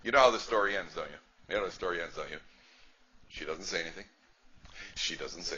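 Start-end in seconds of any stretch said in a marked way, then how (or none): none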